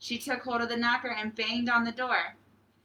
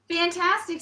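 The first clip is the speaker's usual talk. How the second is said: excited praise; 7 semitones higher, 6 dB louder than usual. Acted. Princess flatters the dragon.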